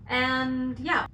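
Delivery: ordinary delivery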